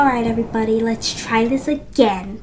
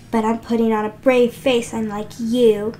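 usual speaking voice

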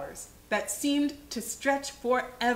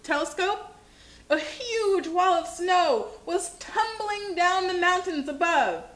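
plain reading voice

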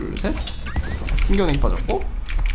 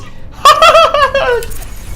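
very high burst of laughter; exasperated laugh; 4 dB louder than usual. Real, not acted. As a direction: laugh in disbelief. Laughing in frustration.

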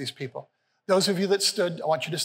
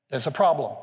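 ordinary delivery

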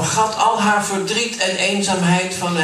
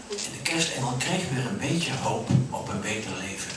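usual speaking voice

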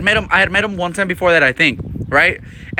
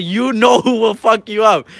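higher, slow teasing threat; drawn-out trash talk, pitched up; retold taunt; 6 semitones higher, slower than usual. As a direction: stretch it out to needle the rival.

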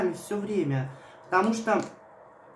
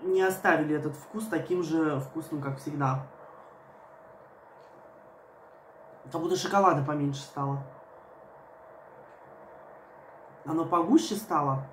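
speaking over the cup rim; talking just after swallowing; sipping between words. Real, not acted. Seen 0: cup at lips, sips repeatedly between words; R frames show no cup.

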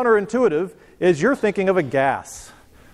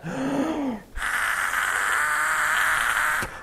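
long hard breath blown out; long hard blow; 10 semitones higher, 5 dB quieter than usual. Real, not acted. Teacher blows into the funnel to lift a ping pong ball, long hard blow.

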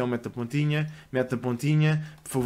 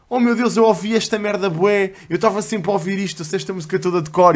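excited fan; 5 semitones higher, 5 dB louder than usual. Retold or imitated. Excited fan quote retold.

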